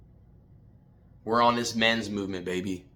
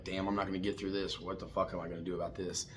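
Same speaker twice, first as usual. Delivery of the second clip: much softer, lightly acted despair; discouraged inner thought; 3 semitones lower, 11 dB quieter than usual. Coach imitating a struggling man's thoughts, quietly discouraged. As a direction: drop the volume like muttered self-doubt.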